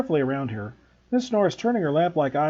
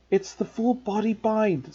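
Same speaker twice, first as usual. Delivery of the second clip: slow, slightly higher, unhappy explanation; a touch of misery; 6 semitones higher, slower than usual. Acted. Hermione to Harry over frozen Neville, mildly miserable. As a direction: explain it as if it hurts to say. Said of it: "said Hermione miserably".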